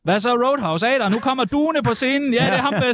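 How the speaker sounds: high-pitched hype for a band entrance; mock stage announcer. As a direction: playfully announce the act to a club crowd. Mimics announcer introducing band at Roadhouse.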